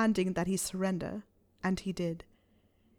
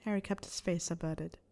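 usual speaking voice

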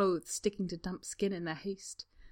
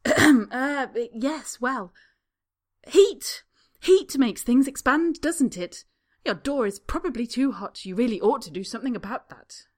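much higher and louder, bluffing hard; strident bluster; 5 semitones higher, 10 dB louder than usual. A narrator's reading. Draco bluffing the spa woman stridently. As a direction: bluster loudly to cover the lie. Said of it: "as stridently as he can manage".

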